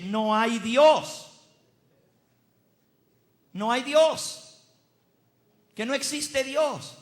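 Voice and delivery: loud, high, slow proclamation; proclamación provocadora. Acted. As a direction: proclaim it loudly to a doubting congregation. (proclamación fuerte, aguda y pausada)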